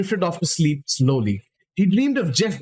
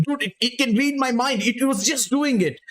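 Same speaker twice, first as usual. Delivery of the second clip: higher, frantic sputtering; 7 semitones higher than usual. A narrator's reading. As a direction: sputter it out in disbelief. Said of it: "started sputtering".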